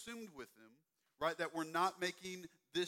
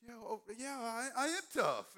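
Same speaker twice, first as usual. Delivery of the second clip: proud little camper; 6 semitones higher, 3 dB louder than usual. Retold or imitated. Camper answering the counselor, mock proud. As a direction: puff up like a brave kid. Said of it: "They're like".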